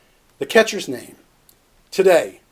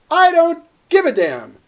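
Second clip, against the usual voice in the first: higher, pointed repeat of the punchline; asked to repeat, he says it higher and pointed; louder repeat; 7 semitones higher than usual. Acted. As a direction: say it again so he hears it. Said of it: "What was that?".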